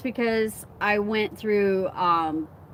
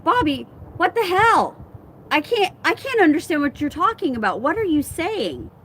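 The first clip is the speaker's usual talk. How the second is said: higher, rapid-fire retold complaint; exasperated; 7 semitones higher than usual. Telling Bobby he can't be understood, exasperated. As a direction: replay an exasperated challenge, pitched up.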